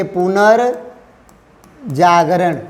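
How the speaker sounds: पुनर्जागरण drawn out syllable by syllable; slow breakdown of पुनर्जागरण for students; slow spelled out. Shown as a demonstration. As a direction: stretch पुनर्जागरण very slowly, sound by sound. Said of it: "ऐसे देसी भाषा में लिखिए".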